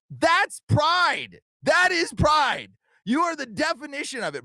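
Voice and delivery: pride called out high and loud; emphatic outburst. Real, not acted. Emphatic rebuke of critics.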